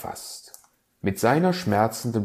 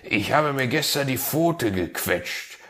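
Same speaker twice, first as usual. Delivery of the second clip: fox voice; 7 semitones higher, 4 dB louder than usual. Acted. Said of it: "begann der Fuchs".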